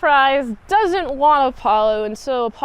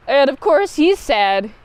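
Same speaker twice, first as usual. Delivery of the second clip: ordinary talk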